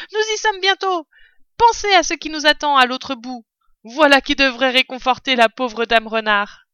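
cri d'encouragement, haut et fort; hurlement. A narrator's reading. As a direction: crie pour entraîner toute la troupe. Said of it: "hurlait maître Renard". (renard qui hurle à ceux qui courent)

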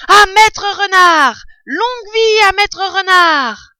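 toast hurlé, aigu, syllabes étirées; acclamation criée. A narrator's reading. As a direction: acclame lentement à pleine voix. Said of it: "crièrent-ils en chœur". (cri de banquet, très haut et traînant)

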